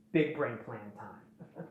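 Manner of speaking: low, slow and quiet, a little sly; lightly voiced scheming villain, slow and low; smug schemer voice. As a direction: drop the voice down and savour the scheme. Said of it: "He's like".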